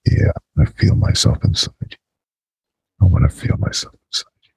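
hushed sultry whisper. Acted.

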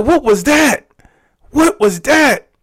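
high, loud, amazed exclamation; stunned disbelief. Retold or imitated. Asking himself what just happened, stunned high cry. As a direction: cry it out high, both times. Reliving shock after the experience.

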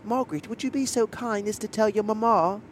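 high-pitched British girl impression; mock British accent. Imitating girls playing British on scooters, high mock-British voice. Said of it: "trying to convince us they were British".

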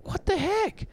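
can't believe she blew it; exasperated brother. Retold or imitated. Brother scolding his sister after the call, high indignant exasperation. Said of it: "And he's like".

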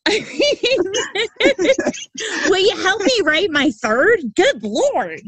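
high, laughing half-joke; host laughs while asking the author for help. Real, not acted. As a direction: laugh through the plea. Host laughs at guest's output.

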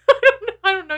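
laughing through the words; a real giggle at herself. Real, not acted. Laughs at own age.

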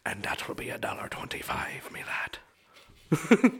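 whispery Irish sign-off; Irish accent. Acted. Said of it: "that's my Irishman. Opening and close".

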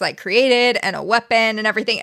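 ordinary delivery

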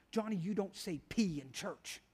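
low, very soft correction; hushed motherly reproof. Retold or imitated. Mother to little Johnny during church, hushed reproof. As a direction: quietly set the boy straight in church.

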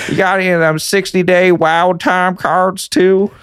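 high, hammed-up Southern accent; a Canadian's Southern accent. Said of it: "a Canadian doing a Southern accent".

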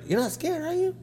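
high, softened question rising at the end; soft teasing check. Retold or imitated. Barber checking on nervous customer, high and soft. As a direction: ask it high and light, imitating the barber.

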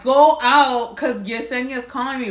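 ordinary delivery

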